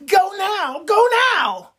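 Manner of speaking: put-on impatient little-girl shriek; urgent shrieking demand. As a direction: screech at him to go right now. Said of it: "Ursula is like".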